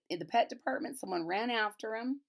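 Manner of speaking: ordinary delivery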